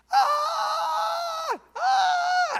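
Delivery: high shrill retold screams of a panicking lady; imitated screaming. Preacher imitates a drowning lady screaming. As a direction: shriek like a woman in panic in the water. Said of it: "we hear this screaming right behind us".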